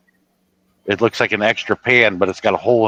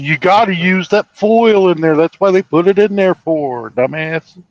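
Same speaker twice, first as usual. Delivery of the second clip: mocking scold; 8 semitones higher, 3 dB louder than usual. Friends mocking him over burnt pizza.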